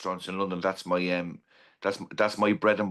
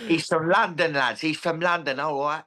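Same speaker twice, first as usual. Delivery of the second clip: put-on London accent; 6 semitones higher, 3 dB louder than usual. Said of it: "His accent".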